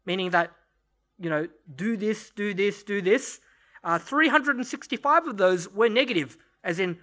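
normal talking voice

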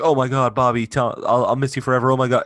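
loud, high mock panic; panicked goodbye. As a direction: ham up a frantic farewell. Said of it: "they'd be like".

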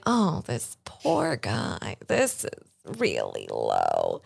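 slow, soft pity for a poor guy; slow, quiet mock pity; pitying sigh. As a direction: sigh over him as if he is pathetic. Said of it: "She's like".

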